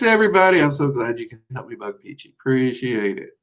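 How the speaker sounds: ordinary delivery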